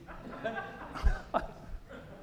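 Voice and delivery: airy laugh up high; high breathy laugh. Real, not acted. Laughs at Stanley's reply.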